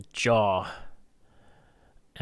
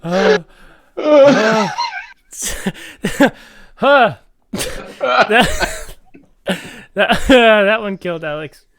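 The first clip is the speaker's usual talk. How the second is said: laughing; 10 semitones higher, 11 dB louder, much slower than usual. Real, not acted. Laughing after the joke.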